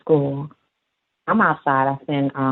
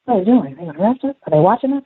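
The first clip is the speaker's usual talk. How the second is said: lightly voiced child, higher pitch; worried child; 6 semitones higher, much faster than usual. Little boy asking about the police, mildly worried high voice. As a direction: imitate a kid firing off worried questions.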